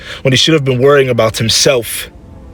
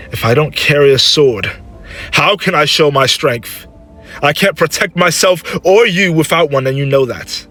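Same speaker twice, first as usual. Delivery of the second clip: raised, bitter argument; heated lashing out; 5 semitones higher than usual. Acted. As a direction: lash out at mother to wound her. Son lashes out at mother.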